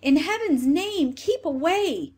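high, loud plea, slowing at the end; screamed warning. A narrator's reading. Two stuck sisters warning the third, screamed plea. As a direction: cry out high and emphatic to warn her off. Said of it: "screamed the other two".

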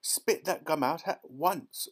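alarmed father laying down the law; stern order. A narrator's reading. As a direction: order it firmly, stressing each word. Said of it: "ordered Mister Beauregard".

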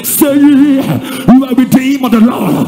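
fervent, chant-like preacher's plea; impassioned preaching. Real, not acted. Preacher confessing for the sinners, pleading for mercy, impassioned preaching. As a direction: cry out each line as an urgent public confession.

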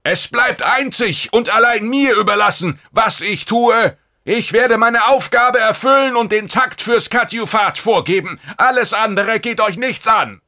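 shrieking defiance at the intruders; furious shout. Acted. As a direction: scream them out of his refuge. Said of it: "schrie Lekore".

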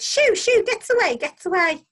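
high, loud shooing; piercing, insistent shouts; jeering jackdaw shouts. A narrator's reading. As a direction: chase the stranger away like a mobbing flock. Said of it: "all the other jackdaws joined in".

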